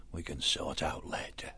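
breathy whisper, low and soft; whispered aside. A narrator's reading. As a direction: drop to a whisper as if thinking aloud. Badger aside after "friend or foe.

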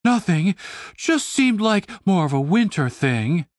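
lightly put-on voice covering a slip; a hint of a fake voice; disguised voice. A narrator's reading. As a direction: backtrack meekly in a disguised voice. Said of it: "she continued in her disguised voice".

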